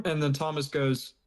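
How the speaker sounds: normal talking voice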